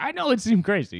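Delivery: hammed-up insisting he knows; mock emphatic.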